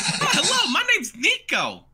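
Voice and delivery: high, loud cartoon shopkeeper; Nico the cat merchant. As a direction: introduce yourself in a piercingly high cat-merchant voice. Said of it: "He goes".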